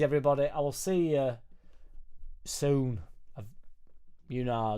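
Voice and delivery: ordinary talk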